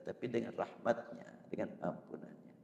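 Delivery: softly whispered plea; whispered, humble plea for mercy. Real, not acted. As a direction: drop to a whisper, as if asking forgiveness. Praying to be saved by mercy.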